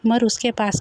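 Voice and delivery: usual speaking voice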